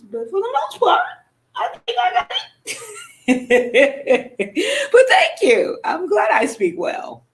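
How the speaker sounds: high, laughing sarcasm breaking into a shout; sarcastic laugh. Real, not acted. Answering the backhanded compliment with a high, laughing sarcasm.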